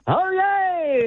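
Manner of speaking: very high, excited whoop; excited crier call. Shown as a demonstration. Coach showing how it has to be, excited. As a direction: sing it out high and thrilled. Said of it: "So it has to be".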